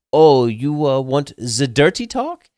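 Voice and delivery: mock European accent, rising into the question; European lover accent. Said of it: "hooked up with this French dude".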